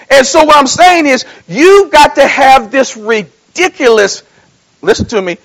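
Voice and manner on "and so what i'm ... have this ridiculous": preaching at full force. Real, not acted. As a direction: drive it home to the church. Preaching continues.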